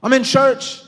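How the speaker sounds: loud, high preaching climax; full-force preaching. Real, not acted. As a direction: pour it out to the congregation, loud and high.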